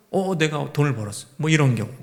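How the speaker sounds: ordinary delivery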